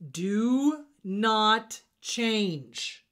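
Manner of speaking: a loud, slow mantra for the audience; slow emphatic. Shown as a demonstration. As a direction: do not change, every word slow, loud and weighted. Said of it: "say it with me".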